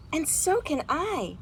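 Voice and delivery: lively storybook read-aloud with big feelings; reading to children. A narrator's reading. Teacher reading a picture book aloud to her class, animated. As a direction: read it like a bedtime story for kids.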